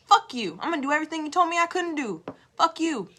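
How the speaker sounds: fierce, fast defiant outburst; defiant shout. Acted. Speaking to the people who doubted, a defiant shout. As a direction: yell it straight at the doubters, loud and high.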